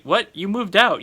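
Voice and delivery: can't believe the nerve; answering the ex's controlling text in disbelief; incredulous. Real, not acted. Reacting to the ex's old text.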